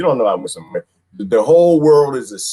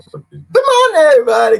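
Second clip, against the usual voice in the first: calling out greeting; 6 dB louder than usual. Acted. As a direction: call out a big high-pitched good morning.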